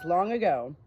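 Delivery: ordinary delivery, nothing marked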